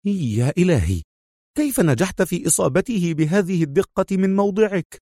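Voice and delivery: high-pitched astonished question; stunned murmur. A narrator's reading. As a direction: marvel in stunned disbelief. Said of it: "في ذهول وغمغم".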